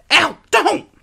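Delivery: very high, loud yelp; pained shriek. A narrator's reading. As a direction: shriek as the hook is pulled. Hook being pulled from foot.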